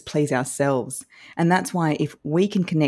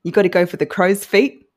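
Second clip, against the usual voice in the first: ordinary talk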